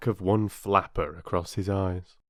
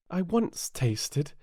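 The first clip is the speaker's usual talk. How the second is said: put-on higher, slow girl's voice, about to blurt; Alice, slowly; 8 semitones higher, slower than usual. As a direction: start the confession, then stop. Alice almost says too much.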